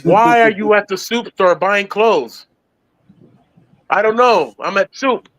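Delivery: high, puppet-like Kermit; high playful frog voice. Retold or imitated. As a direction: chirp it up like the frog. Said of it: "He's like".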